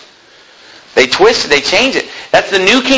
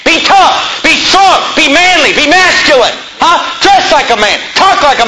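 preaching shout; 6 semitones higher than usual. Real, not acted. Preacher urging the men in his congregation, shouting. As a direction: bellow each command up high.

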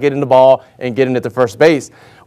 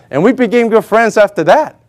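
normal talking voice

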